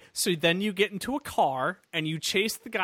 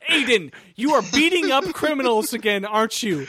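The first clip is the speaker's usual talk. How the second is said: loud, high exaggerated accusation; mock scolding sister; 5 semitones higher, 7 dB louder than usual. She's like that while he fights, mock scolding sister. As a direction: scold him loudly over the phone, hammed-up.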